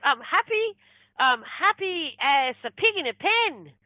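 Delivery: high, drawn-out happy declaration; overjoyed. Retold or imitated. Retells patient's joy after healing.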